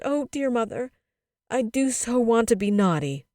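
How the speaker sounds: put-on high, pleading child's wail; sobbed. A narrator's reading. Child confesses a wish to mother, sobbing. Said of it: "she sobbed".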